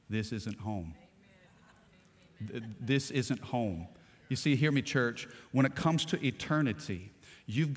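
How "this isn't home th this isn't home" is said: low, very soft, slow hushed words; soft whisper. Acted. The Lord whispering to the missionary, low and soft. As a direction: breathe it low and gently. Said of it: "heard the Lord whisper in his ear".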